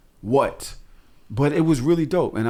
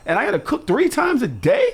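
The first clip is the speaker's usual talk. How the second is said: much higher, exasperated complaint; 13 semitones higher, 3 dB louder than usual. Real, not acted. Dad ranting about cooking three times a day, exasperated. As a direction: sound overwhelmed and incredulous. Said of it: "complaining about cooking".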